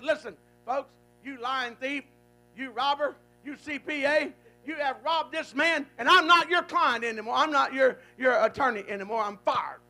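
attorney raging at the thief; retold angry denunciation. Attorney denouncing the crooked accountant, loud and angry. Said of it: "the attorney said".

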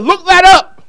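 a booming heavenly order; God commanding from above. Retold or imitated. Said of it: "and God said".

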